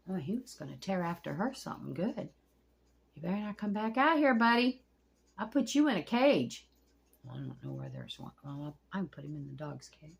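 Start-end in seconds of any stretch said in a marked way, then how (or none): 3.1-6.7 loud, higher warning; threatening scold. Real, not acted. Warning the turtle not to come back, loud and cross. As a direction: warn him off like a cross parent.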